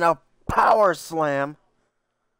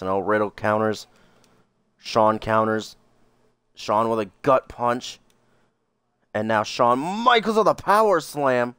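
excited play-by-play call; wrestling commentator; 6 semitones lower than usual. Acted. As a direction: call the match like a ringside announcer. Plays wrestling commentator over game.

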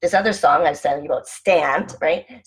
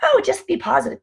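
much higher, sugary mock advice; mock positivity; 13 semitones higher than usual. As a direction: parody the empty "be positive" crowd. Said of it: "This is why people say".

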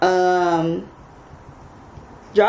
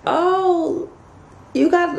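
high-pitched imitated coo; imitated passerby, pitched way up; cooing lady; 10 semitones higher, much slower than usual. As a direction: gush over a puppy in a high voice. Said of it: "this lady was like".

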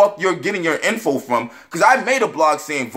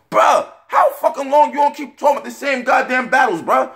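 exasperated rant; 7 semitones higher than usual. Real, not acted. Berating rapper over old battles.

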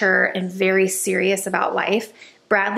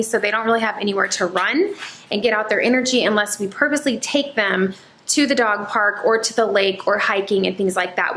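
ordinary talk

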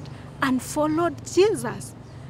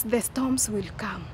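whisper; 2 semitones lower, 6 dB quieter than usual. Acted. Sudden hush in the lesson.

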